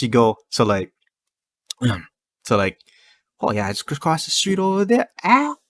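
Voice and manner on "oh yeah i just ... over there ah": Hawaiian Pidgin speech. Retold or imitated. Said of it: "after every word or after every sentence".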